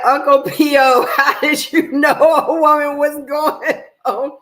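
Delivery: loud, high and laughing as she quotes her; half-laughing imitation of the contestant; laughing quote of a reality show line; quoting a contestant. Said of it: "She said".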